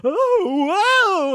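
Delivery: high startled gasp of a word; mock startled whoa. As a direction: yelp it like caught off guard. Retelling the guy's startled reaction.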